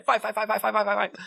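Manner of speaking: high, rapid-fire mock chant; mock shouting. Imitating Erin at the mirror, high mock shouting. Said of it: "Erin's shouting himself in the mirror".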